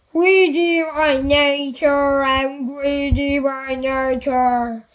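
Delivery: loud, slow giant bellow. A narrator's reading. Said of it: "he bellowed".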